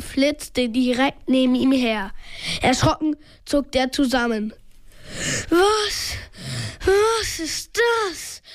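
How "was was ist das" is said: high, slow, panting fright; breathless, drawn-out question; gasping. A narrator's reading. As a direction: gasp it out in acted alarm. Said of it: "keuchte er".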